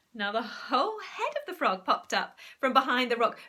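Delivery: ordinary delivery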